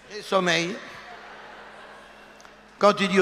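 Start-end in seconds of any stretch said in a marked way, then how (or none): 0.1-0.7 lightly voiced drowsy husband; mock sleepy man. Shown as a demonstration. Husband saying he is sleepy, a hint of drowsy voice. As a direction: say it like a man ready for bed. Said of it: "ça veut dire".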